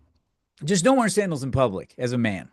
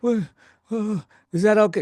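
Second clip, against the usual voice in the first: high-pitched, flustered old-lady imitation; hammed-up alarmed little old lady; mortified old lady; 7 semitones higher than usual. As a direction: play a mortified old woman, voice raised high. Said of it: "just looks mortified".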